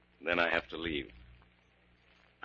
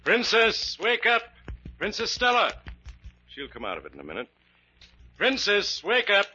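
calling out; 14 semitones higher, 4 dB louder than usual. Acted. Trying to rouse the medium.

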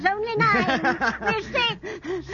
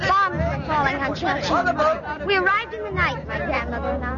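story narration; 6 semitones lower than usual. A narrator's reading. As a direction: tell it like a memory.

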